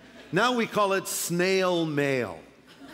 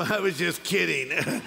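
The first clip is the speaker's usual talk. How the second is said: sheepish laugh; slower than usual. Retold or imitated. Backpedalling email.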